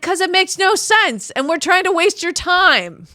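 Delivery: high, loud sarcastic clerk voice; mock clerk. Comic retelling of clinic staff, high and loud mock voice. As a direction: parody the staff as cheerfully absurd.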